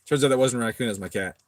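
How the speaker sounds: normal talking voice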